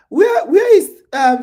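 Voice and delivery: high, loud excited calling; eager search. Real, not acted. Host excitedly looks for a viewer's name in chat. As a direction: hunt for the name, raising the voice.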